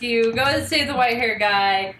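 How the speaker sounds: usual speaking voice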